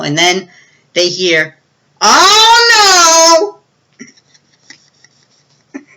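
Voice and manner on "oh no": high, loud anguished wail; dismayed cry. Retold or imitated. Dad finding the puppies in the basement, wailing high and loud. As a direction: let out a long despairing "no".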